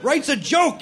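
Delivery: ordinary delivery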